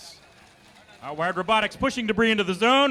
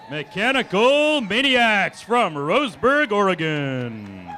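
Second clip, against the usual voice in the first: slow, drawn-out, louder hype intro; fighting game announcer; 4 dB louder, slower than usual. Acted. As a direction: stretch every syllable like a ring announcer. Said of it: "game announcer".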